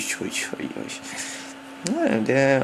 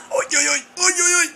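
excited squeal. Real, not acted. Amazed at standing by Zlatan.

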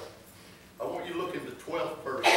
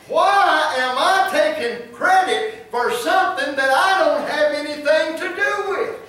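loud, high-pitched preached question; forceful self-questioning; 12 semitones higher, 11 dB louder than usual. Retold or imitated. Preacher questions himself about taking credit, loud and forceful. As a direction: demand an answer of yourself from the pulpit.

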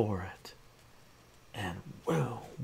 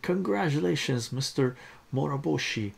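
lightly acted, raised congratulatory voice; hearty congratulations; 6 semitones higher, 4 dB louder than usual. A narrator's reading. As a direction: congratulate the hero with some fanfare. Officials congratulate the winning hero.

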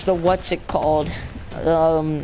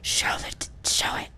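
whispered plea; 8 dB quieter than usual. Real, not acted. Hushed pleading at cutscene.

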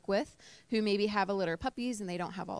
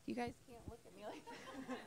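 much softer, laughing through tears; embarrassed laugh; 3 semitones higher, 19 dB quieter, faster than usual. Real, not acted. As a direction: laugh it off while still teary. Tearful speaker teased by audience.

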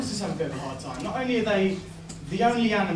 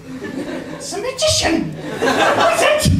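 much higher and louder, slow, laughing through the words; laughing squeal; 11 semitones higher, 8 dB louder, much slower than usual. Retold or imitated. Mocking his childhood awe at Jesus' magic, a laughing high squeal.

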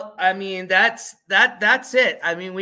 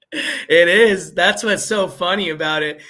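louder, higher words bursting with laughter; laughing hard; 5 semitones higher, 8 dB louder than usual. Real, not acted. Streamer explaining to chat why he's laughing, loud and laughing. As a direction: talk through real laughter. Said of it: "That's why I'm laughing".

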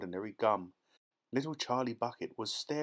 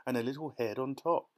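ordinary delivery

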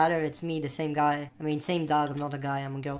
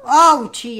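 loud ouchie; 7 semitones higher, 13 dB louder than usual. Pretend. Fell, cries out.

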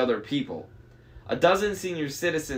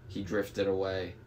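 usual speaking voice

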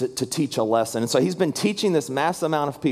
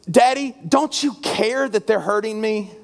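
put-on high-pitched, hurt plea of a little boy; imitated tearful child; 7 semitones higher than usual. Crying son asks his daddy during the shot, imitated tearful plea. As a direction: plead like a crying child, without real sobs. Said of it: "through tears".

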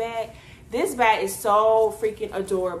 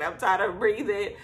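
normal talking voice

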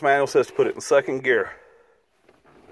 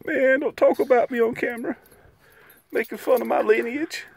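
high voiced dog complaining; dog's voice; 10 semitones higher than usual. Owner voicing Martini the dog answering, high and squeaky. Dog protests being teased.

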